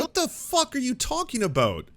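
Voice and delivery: high, stretched disbelieving yell; outraged at what he just heard; real shock, pitch leaping up; incredulous outburst. Real, not acted. Outraged at the clip's claim.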